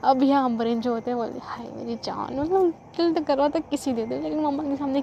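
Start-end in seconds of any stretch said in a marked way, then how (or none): 1.4-2.4 softly cooing. Retold or imitated. Girlfriend greeting lover secretly.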